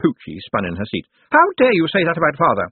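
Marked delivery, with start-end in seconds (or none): 1.3-2.7 high-pitched, indignant protest; outraged. A narrator's reading. Pucci springs to her father's defence, outraged.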